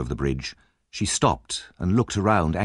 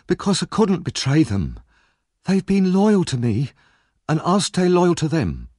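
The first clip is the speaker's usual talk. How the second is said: put-on higher, anxious young woman's voice; Nancy, tense and fearful, pitched up; frightened young Nancy; 10 semitones higher, 4 dB louder than usual. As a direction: voice the scared girl on edge. Nancy's voice held in reading.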